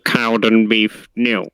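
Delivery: slow, drawn-out score reading; football results announcer. Retold or imitated. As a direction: imitate the classified results reader. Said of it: "I was just doing the voice".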